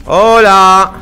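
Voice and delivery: loud, drawn-out hello; loud call. Shown as a demonstration. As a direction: call out at full voice. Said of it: "los enemigos también me escuchan". (saludo a gritos)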